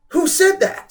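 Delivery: put-on high, loud girl's demand. A narrator's reading. Girl demanding who spoke, high and loud. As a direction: Samantha voiced high and sharp. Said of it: "Samantha demanded".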